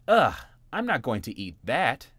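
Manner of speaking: loud grossed-out groan of a little bunny; loud childish disgust. Acted. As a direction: turn your nose up at the vegetables. Jimmy disgusted at vegetables.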